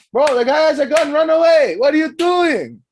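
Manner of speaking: loud, high-pitched panicky warning; full-volume comic outrage; exasperated yelling. Acted. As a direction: scream sense at a clueless bystander. Yelling at the imagined onlooker.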